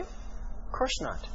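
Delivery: breathy near-whisper, slow and soft; hushed aside. Acted. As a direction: drop to a whisper for the obvious answer. Aside answering his own question.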